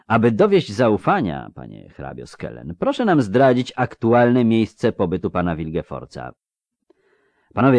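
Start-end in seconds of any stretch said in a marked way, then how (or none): none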